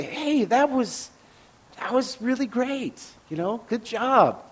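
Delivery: high, slow, soft pat-on-the-head praise; patronizing. Retold or imitated. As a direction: talk down kindly like to a child. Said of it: "it was a bit patronizing".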